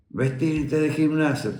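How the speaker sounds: high, stretched-out call; slow, high-pitched order to conscripts; imitated military order. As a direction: mimic a drawn-out barracks command without shouting. Imitates next barracks order.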